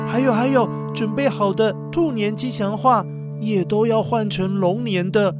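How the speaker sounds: plain reading voice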